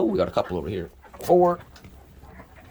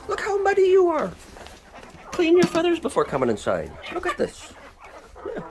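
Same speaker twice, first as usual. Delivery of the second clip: high sing-song scolding; pet baby talk. Acted. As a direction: tease the muddy bird like a toddler. Scolding a muddy chicken.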